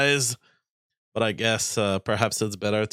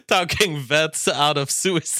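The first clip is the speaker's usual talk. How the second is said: reads the words through real laughter; cracking up mid-sentence, higher and louder; laughing while reading; 5 semitones higher, 3 dB louder than usual. Real, not acted. Reading a viewer's joke comment.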